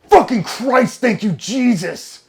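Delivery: high, loud, stretched-out jubilation; screamed relief. Retold or imitated. Trainee drafted to his dream squadron, screamed relief. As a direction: shout thanks to the heavens. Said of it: "I fucking screamed".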